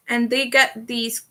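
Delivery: ordinary talk, nothing marked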